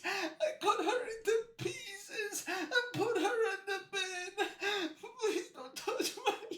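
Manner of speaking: high, thin tearful whimper; mock sobbing plea. Killer confessing to save his leaves, high mock sobbing. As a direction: beg through exaggerated, gasping sobs.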